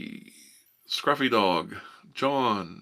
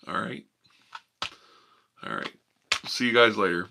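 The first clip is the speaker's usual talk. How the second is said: normal talking voice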